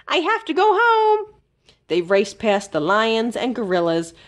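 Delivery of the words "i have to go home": high, bright sing-song farewell; little hamster calling to the exhibits; calling goodbye. A narrator's reading. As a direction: call out a cheerful goodbye while running. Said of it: "Fergus called".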